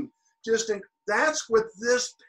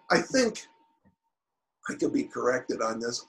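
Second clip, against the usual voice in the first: ordinary talk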